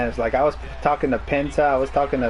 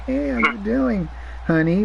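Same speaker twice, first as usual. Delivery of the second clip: sweet greeting; 6 semitones higher than usual. Retold or imitated. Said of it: "She was like".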